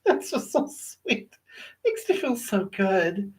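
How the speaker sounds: soft, slow, half-laughing gratitude; quiet and moved, with a little laugh; touched, giggly thanks. Real, not acted. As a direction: let the love get to you. Viewers filling her with love.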